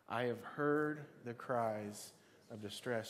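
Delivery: slow, drawn-out group recital; slow unison recital. Shown as a demonstration. Preacher reciting the verse together with the congregation, slow. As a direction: lead them through it slowly. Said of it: "Let's say that together".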